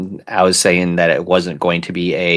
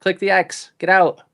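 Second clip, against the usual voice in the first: panicked; 11 semitones higher, 3 dB louder than usual. Real, not acted. Co-host clicked an ad live.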